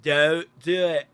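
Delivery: hammed-up English accent. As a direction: repeat the warning in broad Cockney. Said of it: "English accent".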